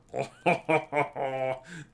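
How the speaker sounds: drawn-out laugh at own joke. Real, not acted. Streamer after telling a dad joke, drawn-out laugh. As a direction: laugh long at your own punchline.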